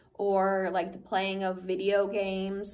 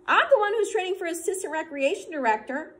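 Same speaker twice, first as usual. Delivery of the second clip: much higher, louder indignant retort; angry; 10 semitones higher, 7 dB louder than usual. Acted. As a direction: put him in his place, indignant. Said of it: "Asher pointed out angrily".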